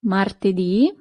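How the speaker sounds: Martedì with the weight on the final dì; stress on the end. Shown as a demonstration. Teacher repeating Martedì to show the end accent. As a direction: hit the last syllable of Martedì. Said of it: "you still have the accent".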